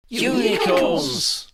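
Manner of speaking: loud, slow, high shouted chorus; a whole class cheering it out. A narrator's reading. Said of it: "the class chorused".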